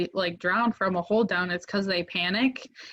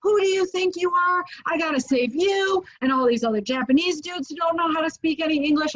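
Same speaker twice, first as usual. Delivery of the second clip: angry friend; 9 semitones higher, 3 dB louder than usual. Retold or imitated. Friend on jet ski scolding reckless surfer, mock furious yell. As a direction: chew him out like a scared rescuer. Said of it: "and he's like".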